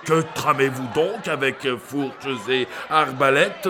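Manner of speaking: usual speaking voice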